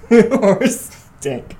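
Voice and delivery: laughing through the words; cracking up as you say it. Real, not acted. Repeats joke laughing.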